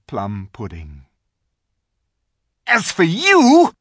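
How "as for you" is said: high, loud contemptuous order; witch's command. A narrator's reading. Witch demanding the silent animals answer. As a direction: spit the insult down at them. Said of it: "said the witch".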